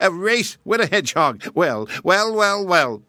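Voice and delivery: amused hare. Acted. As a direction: savor how absurd it is.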